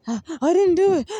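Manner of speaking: high, loud whiny mock denial; weak denial. Mimicking an evil person weakly denying blame, hammed-up whine. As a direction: whine it like a caught kid. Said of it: "that quality of weakness belongs to them".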